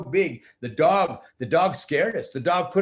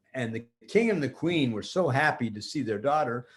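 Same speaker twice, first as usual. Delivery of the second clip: plain reading voice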